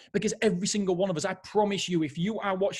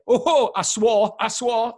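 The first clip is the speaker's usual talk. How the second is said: caught out; 6 semitones higher, 8 dB louder, much slower than usual. Real, not acted. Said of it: "I'm sorry".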